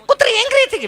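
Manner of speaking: high, panicky mock lady; mimicking scared lady. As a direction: cry out high and flustered.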